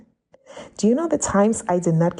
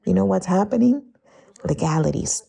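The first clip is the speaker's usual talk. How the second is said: ordinary talk, nothing marked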